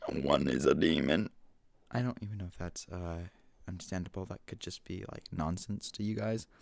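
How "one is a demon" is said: a lightly voiced demon, low and slow. Shown as a demonstration. Showing off his impressions to listeners, a slow low demon voice. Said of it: "I got the many impressions".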